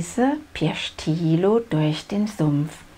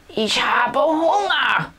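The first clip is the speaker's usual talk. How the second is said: slow, high roaring dino bellow; roaring hunger; 7 semitones higher, 3 dB louder, slower than usual. A narrator's reading. Hungry dinosaur roaring at the swamp, slow roar. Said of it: "brüllt Tilo".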